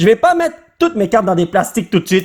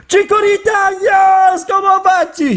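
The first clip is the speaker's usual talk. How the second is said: excited greeting; 12 semitones higher, slower than usual. Real, not acted.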